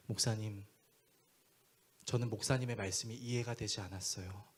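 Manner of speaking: low, soft, humble voice; sincere confession. Retold or imitated. Young man asking pastor to officiate wedding, humble and sincere. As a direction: speak earnestly with pauses, admitting a lesson learned.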